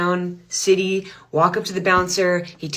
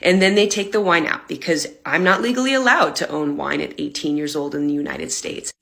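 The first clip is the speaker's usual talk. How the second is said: usual speaking voice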